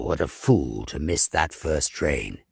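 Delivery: usual speaking voice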